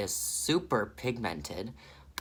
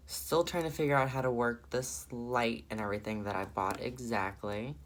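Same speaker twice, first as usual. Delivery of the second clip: ordinary delivery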